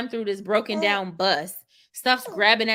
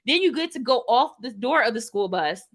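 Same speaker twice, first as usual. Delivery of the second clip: ordinary delivery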